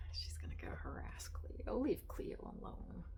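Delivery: whispering to the cat; hushed whispered pet talk. Real, not acted. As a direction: keep it a whisper so you don't break the mood. Whispering to cats on camera.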